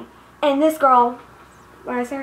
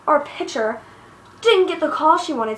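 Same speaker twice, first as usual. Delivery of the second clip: normal talking voice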